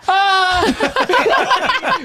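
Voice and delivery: very high imitated "ah" of alarm; high squeal, imitating a horrified onlooker; mock horrified cry. Imitates her gasp at near trampling.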